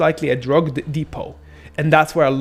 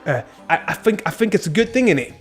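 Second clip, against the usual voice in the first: higher-pitched mock London street slang; British street accent; 6 semitones higher than usual. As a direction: imitate a British roadman's accent. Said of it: "I can do, uh, British ones".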